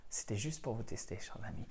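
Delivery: a conspiratorial whisper tossed to the class; teasing whisper. Real, not acted. Teacher covering his own slip to students, whispered joke. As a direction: whisper it like a cheeky secret.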